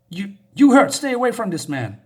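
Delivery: high, loud warning with a stumbled start; retold shout at girl. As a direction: warn the girl off loudly. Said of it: "shouting at the girl".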